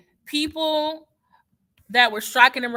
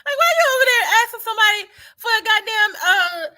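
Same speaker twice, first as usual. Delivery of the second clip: much higher, louder and fast, nearly crying out; exasperated outburst; 13 semitones higher, 7 dB louder, faster than usual. Real, not acted. Venting at someone abroad asking where she's from, exasperated and loud. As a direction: blurt it out in disbelief.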